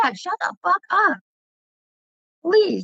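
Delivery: high-pitched, re-enacted pleading; retold exasperated plea. Daughter to dad yelling at drivers, exasperated plea. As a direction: beg an embarrassing dad to quit.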